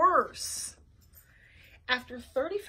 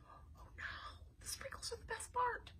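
hushed whisper of dismay; whispered aside; 11 semitones higher, 16 dB quieter than usual. Acted. Whispered aside about stolen sprinkles.

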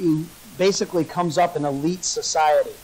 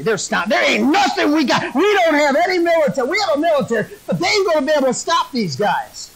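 a hint of a frantic king, higher pitch; lightly voiced worried king; 6 semitones higher, faster than usual. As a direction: plead urgently as the retold king. Balak frantically pleading with Balaam.

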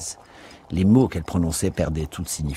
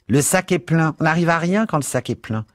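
high-pitched, fussy cleaner's voice; bossy cleaning woman; 9 semitones higher, 3 dB louder than usual. Acted. Missus Biggs grumbling about the vacuum bag, high-pitched. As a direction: complain in a high character voice.

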